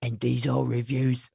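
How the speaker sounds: drawn-out British schoolboy presenter; Nigel. Acted. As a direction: announce it slowly in a put-on British accent. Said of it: "Freaking Nigel".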